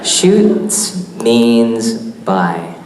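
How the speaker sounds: Shoots means buy, each word dragged out very slowly; slowed-down tongue twister. Shown as a demonstration. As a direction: stretch it out so it can't trip you. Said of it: "I talk too fast".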